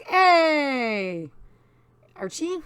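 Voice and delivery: excited greeting. Real, not acted. Player greets returning characters, excited drawn-out cheer. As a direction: draw it out in an excited hello.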